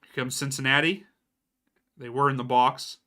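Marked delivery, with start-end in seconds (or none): none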